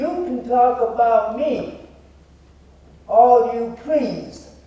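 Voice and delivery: loud, high, slow and pointed; emphatic finger-pointing oratory, word by word; accusatory challenge. Shown as a demonstration. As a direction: throw it at the crowd as a challenge. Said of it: "accusatory, and I'm gonna point my finger".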